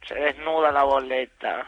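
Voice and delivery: low, hushed seductive murmur; voz seductora. Acted. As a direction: tease it like a strip show. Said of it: "Es un asco".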